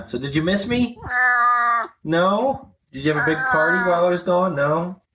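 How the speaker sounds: pet talk. Real, not acted. Cooing to the cat Baron.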